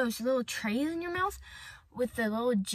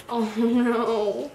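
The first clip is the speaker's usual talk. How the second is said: usual speaking voice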